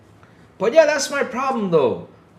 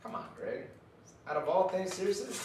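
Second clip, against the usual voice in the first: whispered, much softer disbelief; hushed exasperation; 12 dB quieter, faster than usual. Real, not acted. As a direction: breathe it out, exasperated. Exasperated aside.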